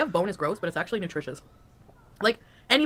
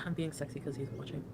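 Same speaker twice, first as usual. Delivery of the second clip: low, soft would-be sexy voice; extremely deep bass voice; 4 semitones lower, 12 dB quieter than usual. Pretend. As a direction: drop the voice deep and play sultry. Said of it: "the lowest voice I can do".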